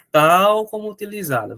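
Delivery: "Tal" hit hard at the start; stress on tal. Shown as a demonstration. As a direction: lean on "Tal como utilizada". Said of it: "Cuidado, viu?". (ênfase em "Tal")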